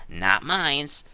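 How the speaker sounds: ordinary delivery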